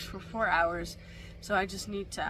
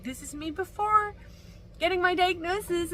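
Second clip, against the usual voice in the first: vlog intro; 8 semitones higher, 4 dB louder than usual. Retold or imitated. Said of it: "I thought I needed to vlog that".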